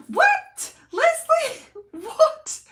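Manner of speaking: shrieking high and loud with real excitement; too excited. Real, not acted. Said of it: "I'm getting too excited".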